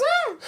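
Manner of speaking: piercing high mock scream; schoolgirl squeal. Calling ex-wife with the news, schoolgirl squeal. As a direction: let out a hammed-up girlish squeal. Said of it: "I squealed like a schoolgirl".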